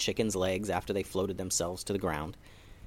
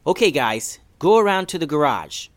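put-on higher, louder little boy calling out; excited boy rounding up chickens; Jackson's voice; 4 semitones higher, 7 dB louder than usual. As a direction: call the chickens over like an eager kid. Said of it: "said Jackson to the chickens".

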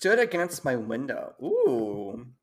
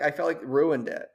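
normal talking voice

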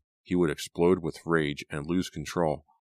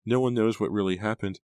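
higher, insistent protest; crying out; 6 semitones higher than usual. A narrator's reading. Daughter disputing father's story, crying out. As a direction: argue back in a higher voice. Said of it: "Nora cried".

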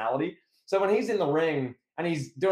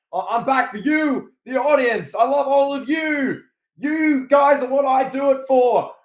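loud, high, hammed-up crowd promo; Cena promo voice; 10 semitones higher, 7 dB louder, slower than usual. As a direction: shout it to the arena like a superstar. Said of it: "he does that promo being like".

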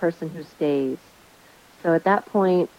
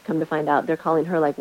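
ordinary talk

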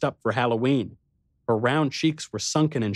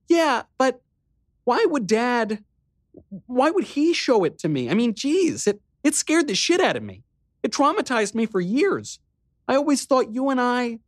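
put-on high girl's voice, shaken; a girl reliving an old fright; upset little sister; 14 semitones higher, 3 dB louder than usual. A narrator's reading. Riley voiced, upset by memory.

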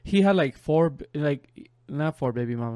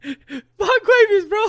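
high, loud, drawn-out laughing squeal; losing it, voice squeaking high and slow; cracking up; 6 dB louder, much slower than usual. Real, not acted. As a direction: wheeze the name out through laughter. Helpless laughter at the name.

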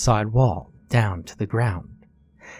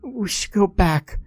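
put-on high young girl, uneasy; higher girl voice; 11 semitones higher than usual. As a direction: voice her light and scared.

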